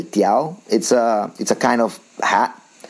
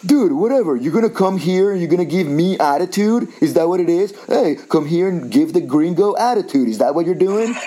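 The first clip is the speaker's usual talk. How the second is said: mock aggressive stranger; 7 semitones higher than usual. Retelling the stranger who called him Mexican, mock aggressive. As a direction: imitate a guy picking a fight over attitude. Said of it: "And he goes".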